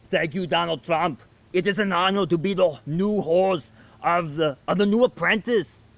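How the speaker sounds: put-on a proud Austrian accent booming a thank-you; Arnold Schwarzenegger impression. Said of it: "Arnold Schwarzenegger, you're hired".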